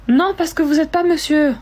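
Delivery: high, loud mock refusal; acted bank refusal. Bank clerk refusing a caller they don't believe, high loud refusal. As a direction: turn the caller down in a hammed-up high voice. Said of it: "qui est au téléphone avec sa banque".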